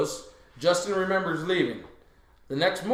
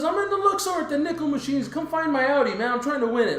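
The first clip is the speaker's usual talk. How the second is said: very high, hammed-up excitement; retold excited answer; 10 semitones higher, 3 dB louder than usual. Friend wanting company at the slot machines, excited. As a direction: imitate an eager gambler, pitched way up.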